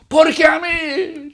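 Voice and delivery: hammed-up wail, high and drawn out; mock sobbing. Believer lamenting a trial, exaggerated crying. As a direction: imitate a hopeless, tearful lament. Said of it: "estamos ahí en llanto partido, llorando".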